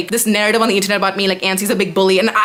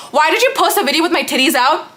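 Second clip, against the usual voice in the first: high, indignant mock whine; mock whiny friend; 7 semitones higher than usual. Vlogger imitating her friend's fake outrage. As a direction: play the friend as falsely outraged.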